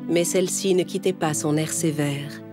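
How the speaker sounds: plain reading voice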